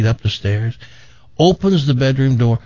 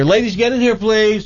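high-pitched summons; calling people in; 9 semitones higher than usual. Real, not acted. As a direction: call them over in a raised, lilting pitch. Calls women into the studio.

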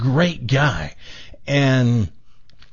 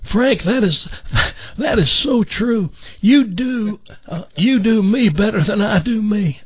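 put-on higher raspy voice with a little southern Missouri accent; Jim McMillan impression; 8 semitones higher than usual. Jim praising Frank's impression, in raspy southern voice. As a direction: chuckle through it like a friendly garden-show host. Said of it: "I do an absolute perfect impression of you".

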